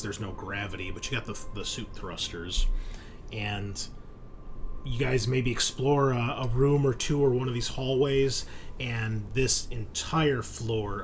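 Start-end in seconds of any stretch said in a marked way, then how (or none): none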